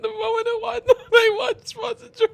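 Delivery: high, wheezing laughter breaking every word; gasping for air through hysterical laughter; can't breathe laughing. Real, not acted. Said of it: "Bro, I can't breathe".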